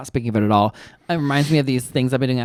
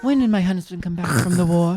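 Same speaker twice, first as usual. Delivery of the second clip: put-on old-timey wife; 3 semitones higher, 4 dB quieter than usual. Old-time wartime wife role.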